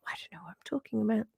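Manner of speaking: quick breathy whisper under the breath; whispered aside. Real, not acted. Host to her live viewers, a quick embarrassed whisper. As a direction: throw it away in a fast whisper.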